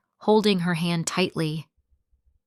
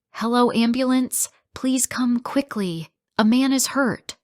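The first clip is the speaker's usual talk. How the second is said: urgent call; 6 semitones higher, 3 dB louder than usual. Acted. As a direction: call the ambulance in a panic. Mother calls ambulance.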